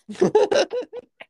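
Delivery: loud high-pitched burst of laughter. Real, not acted. Laughing at the Atlanta story, high loud laugh.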